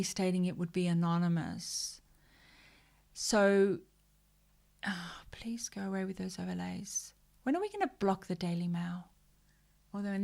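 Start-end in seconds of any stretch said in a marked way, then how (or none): none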